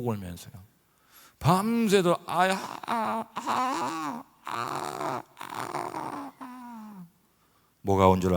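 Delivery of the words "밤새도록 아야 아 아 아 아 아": high, fading groans of "아야, 아"; mock pain moans. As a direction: moan in pain through the night. Imitating wife's pain moans. (높고 점점 약해지는 신음 흉내)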